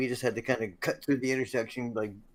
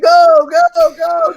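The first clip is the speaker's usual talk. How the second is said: very high, loud cheering yell; crowd screaming; 18 dB louder, much slower than usual. Retold or imitated. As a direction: scream it out the car window. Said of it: "everyone's hanging out of their cars screaming".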